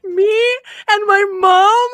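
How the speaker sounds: loud, high, slow through laughter; laughing. Real, not acted. Retelling the church moment while laughing loudly. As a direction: tell it while cracking up. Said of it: "burst into laughter".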